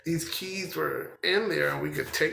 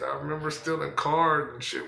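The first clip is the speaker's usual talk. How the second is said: normal talking voice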